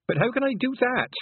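high wailing plea. A narrator's reading. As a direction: wail it in dismay. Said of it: "wailed Tiffany".

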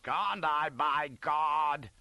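loud, indignant officer's roar. A narrator's reading. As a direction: roar back defiantly at a subordinate. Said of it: "roars Scarlet".

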